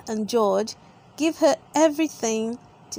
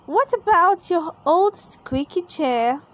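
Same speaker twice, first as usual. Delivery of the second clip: put-on high helpful little girl voice; lightly voiced helpful little girl; helpful Peppa; 7 semitones higher, 4 dB louder than usual. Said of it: "suggests Peppa helpfully".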